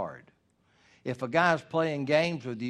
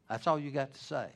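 ordinary talk, nothing marked

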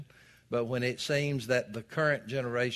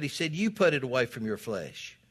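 ordinary talk

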